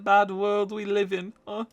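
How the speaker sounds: hammed-up French chef accent; a hint of a comic French chef.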